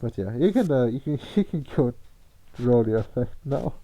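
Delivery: muffled behind a hand; hand over mouth. Real, not acted. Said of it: "Tom, you sound really shitty".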